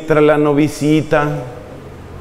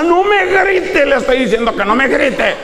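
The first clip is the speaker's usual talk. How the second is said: furious shout; 9 semitones higher than usual. Acted. Bride screaming at her husband not to shout at her, furious. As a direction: yell it right back at him, outraged.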